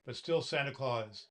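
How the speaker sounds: usual speaking voice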